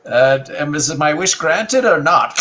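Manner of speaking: a hint of a high character voice; lightly voiced demanding creature. Voicing a toy character.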